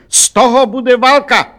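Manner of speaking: high, furious threat; shouting. A narrator's reading. Count threatening shopkeeper with war, shouting. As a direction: shout it way up in pitch. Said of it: "křičel hrabě Rudolf".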